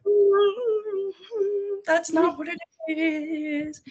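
very high, drawn-out doubtful hum and words; skeptical objection. Retold or imitated. Listener rejecting the southern accent excuse, skeptical objection. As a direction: squeak out a wary disagreement.

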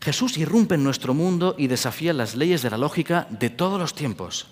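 ordinary talk

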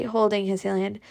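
ordinary delivery, nothing marked

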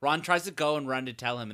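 usual speaking voice

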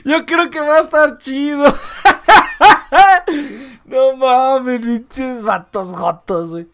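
cracking up, high and loud through laughter; real laughing fit. Real, not acted. Joking with listeners about the Japanese landlady, real laughing fit. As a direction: laugh helplessly through the words. Cracks up while telling the story.

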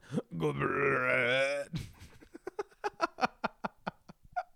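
laughing through a mock grunt; half-laughed imitation of the noise; imitated sex noise laughing. Retelling the porn video to friends, laughing mock noise. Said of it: "on top of you just going".

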